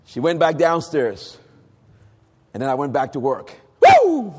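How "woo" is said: loud, high-pitched "woo" shout; excited whoop. Retold or imitated. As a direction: let out a reenacted whoop of joy. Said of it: "I began to shout in my bedroom".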